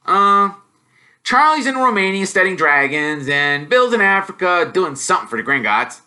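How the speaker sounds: bursting to share news, fast; lightly voiced excited schoolboy; eager boy. Ron voiced by narrator.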